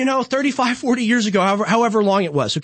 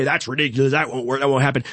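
mock naysayer; 9 semitones lower, faster than usual. Mocking people who object.

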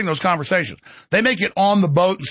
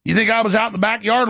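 usual speaking voice

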